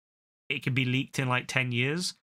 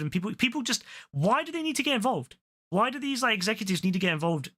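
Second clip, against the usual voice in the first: high exasperated rant; 6 semitones higher, faster than usual. Real, not acted. Ranting at executives.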